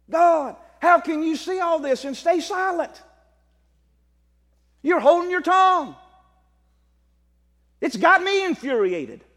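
preacher's rising outcry; fervent outcry. Acted. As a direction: cry out to God in frustration. Preacher voicing Habakkuk's outrage.

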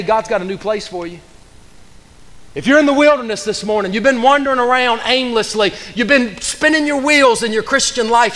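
fervent, driving pulpit delivery; full-force preaching. Real, not acted. Preacher urging the congregation out of the wilderness, fervent preaching. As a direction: preach it hard, punching key words.

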